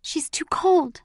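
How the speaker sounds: high, breathy, drawn-out alarm; anxious boy's breathy cry; frightened urgency. A narrator's reading. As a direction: voice thin with fear. Brother frightened for dying mother.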